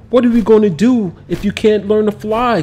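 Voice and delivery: put-on anxious boy fretting aloud; fearful. A narrator's reading. Boy fretting to his dragon, fearful. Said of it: "Kamal feared".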